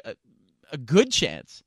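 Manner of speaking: usual speaking voice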